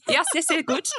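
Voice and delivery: put-on jokey German-accented agreement; mock German. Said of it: "I got the German".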